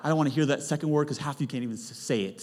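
ordinary talk

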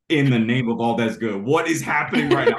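high, loud, half-laughing panic; freaking out. Retold or imitated. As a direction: retell the panic, voice climbing and cracking into a laugh. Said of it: "I was freaking out".

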